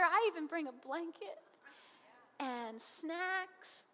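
ordinary talk, nothing marked

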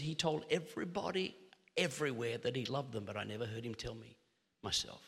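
low, fading, subdued retelling; voice cracking, retold. Preacher retelling his friend's confession about dad, subdued hurt. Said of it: "I heard his voice crack".

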